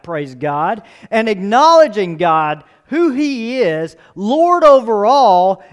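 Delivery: loud, high, slowed proclamation; preaching at full force. Acted. Preacher proclaiming God's lordship to the congregation, loud. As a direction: build to a thundering declaration.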